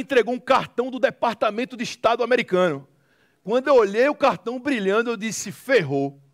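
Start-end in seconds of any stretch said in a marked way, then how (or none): none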